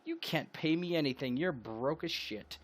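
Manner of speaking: mocking scoff. [name] mocking Fern for being broke, scornful. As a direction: laugh off his offer. [name] mocks Fern's money.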